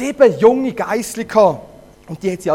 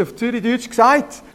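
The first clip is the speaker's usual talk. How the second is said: ordinary delivery, nothing marked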